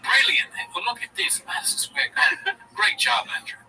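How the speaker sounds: high, mock British father doting; posh English dad. Comic mimicking Schultz's father at the Garden, high mock British. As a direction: play a proud English dad, cheerio and all. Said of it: "His dad's more like this".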